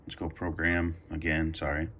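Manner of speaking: ordinary delivery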